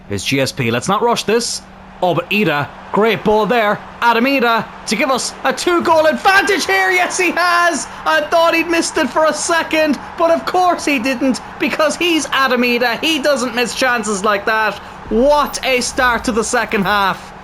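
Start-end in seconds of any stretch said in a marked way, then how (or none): 4.0-15.8 high, joyful goal call; excited shout. Real, not acted. His team scores a fourth goal.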